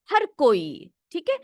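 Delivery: ordinary delivery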